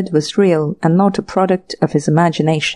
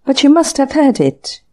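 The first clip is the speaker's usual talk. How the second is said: a bit higher, eager protest; mild exclamation; 7 semitones higher than usual. A narrator's reading. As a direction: exclaim it with rising insistence. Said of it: "he exclaimed".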